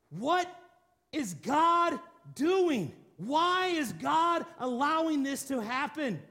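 acted bewilderment at God; bewildered questioning. Acts out his puzzled younger self.

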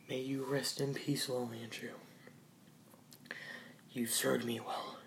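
soft whispered farewell; whispering. Acted. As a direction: breathe it out softly.

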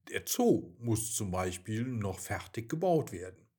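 plain reading voice

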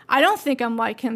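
ordinary talk

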